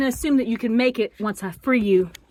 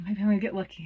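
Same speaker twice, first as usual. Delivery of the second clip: ordinary delivery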